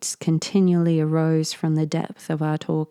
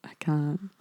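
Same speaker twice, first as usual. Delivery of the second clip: ordinary delivery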